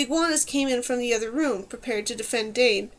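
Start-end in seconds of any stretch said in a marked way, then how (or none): none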